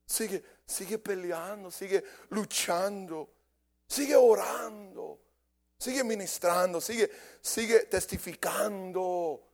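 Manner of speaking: a preacher whipping up the congregation; building preaching. Acted. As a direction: drive it harder with each sigue.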